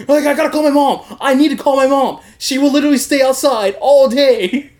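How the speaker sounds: loud, high-pitched mock panic; mock frantic plea. Prisoner begging to call his mom, mock frantic. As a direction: plead urgently, hammed up.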